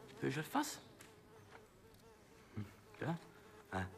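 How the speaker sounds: ordinary delivery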